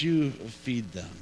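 slow, low and soft; slow low quote. Retold or imitated. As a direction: let the order sink in slowly. Jesus's calm reply again.

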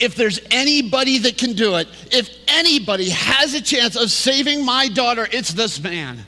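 loud, high-pitched, urgent plea; desperate hope. Retold or imitated. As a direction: build it up as a last hope, easing at the end. Father's desperate hope acted out.

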